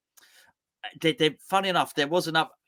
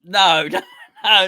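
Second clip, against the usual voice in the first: ordinary talk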